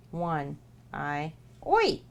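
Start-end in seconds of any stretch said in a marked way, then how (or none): 1.7-2.0 sharp high yelp of oi; high playful shout. Real, not acted. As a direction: bark out a playful oi. Decoding cipher, shouting oi.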